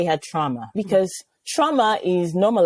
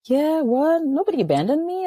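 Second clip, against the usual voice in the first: NOBODY hit higher in disbelief, "Nobody" lifted up; incredulous disbelief; 12 semitones higher than usual. Retold or imitated. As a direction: throw out "Nobody abandoned me" in mock shock. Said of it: "And I was like".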